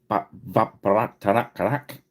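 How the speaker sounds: stuttered run at "Ba-, Va-, Barat-, Tarak-, Karak", each piece ending abruptly; mock pronunciation attempt. Real, not acted. Joking to Rich about a show's name, mock pronunciation attempt at "Ba-, Va-, Barat-, Tarak-, Karak". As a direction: stumble playfully through "Ba-, Va-, Barat-, Tarak-, Karak" as a tongue-twisting name. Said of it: "that's how you pronounce the".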